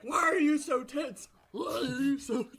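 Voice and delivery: stretched-out high cry, as if shaking someone; shaking teacher. Acted. Teacher shaking a tense student, high hammed-up wail. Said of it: "so she starts shaking you".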